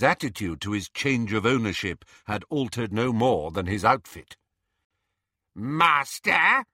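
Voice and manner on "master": croaky elf voice, rising on the call; bullfrog croak. A narrator's reading. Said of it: "croaked Creature in his bullfrog's voice".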